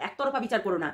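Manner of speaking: a hushed একতরফা বিচার করো না. Shown as a demonstration. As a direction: repeat it quietly, as a soft plea. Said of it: "তোমরা viewers তো".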